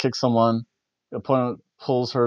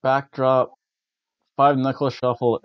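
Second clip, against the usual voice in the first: normal talking voice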